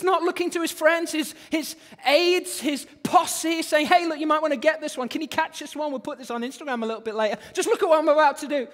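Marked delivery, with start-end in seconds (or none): 3.8-8.7 fast, higher, hyped-up patter, growing louder; mock showman. Mock showman telling his posse to film it for Instagram, self-promoting. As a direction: play a show-off cueing the camera.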